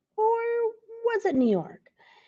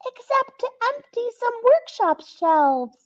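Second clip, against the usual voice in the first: high, loud squeaky elf cry; exclaiming elves; 14 semitones higher, 4 dB louder than usual. Acted. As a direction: elves blurting out in a fluster. Elves continue their line.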